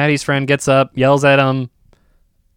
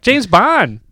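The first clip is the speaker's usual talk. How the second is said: higher-pitched mock kid cheer; excited kid; 6 semitones higher than usual. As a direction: imitate an eager kid announcing the film. Imitating kids arriving excitedly.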